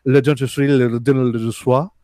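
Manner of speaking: mock French booth guy, mumbled gibberish; strong French accent. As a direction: imitate a thick French accent in nonsense syllables. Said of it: "strong French accent".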